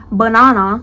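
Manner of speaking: banana as "buh-NAH-nuh", punched out; banana with broad ah. Shown as a demonstration. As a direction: say banana with a broad British-style ah. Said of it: "I find myself saying things like".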